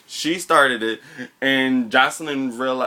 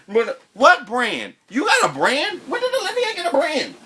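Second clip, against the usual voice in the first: incredulous questions, voice pitched way up; mock disbelief; 12 semitones higher than usual. Host mocking a reality star's brand claim, incredulous. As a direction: scoff at the idea she has a brand.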